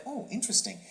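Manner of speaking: high-pitched, intrigued "oh"; high note of piqued interest. Retold or imitated. Recalls reacting with curiosity to comedian.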